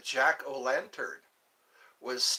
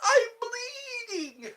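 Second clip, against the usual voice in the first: much higher, slow, hammed-up wail through laughter; laughing mock cry; 4 dB louder, much slower than usual. Mock wailing to chat about bleeding. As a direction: wail it like a joke.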